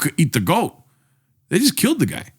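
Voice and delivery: ordinary delivery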